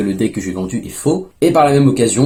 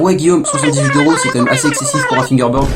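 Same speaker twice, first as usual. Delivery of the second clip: sceptical viewer; 3 semitones higher, 3 dB louder than usual. Pretend. Viewer objecting to a costly deck, mildly put-on sceptical voice. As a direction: play a mildly sceptical fan questioning the price. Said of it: "Vous allez me dire".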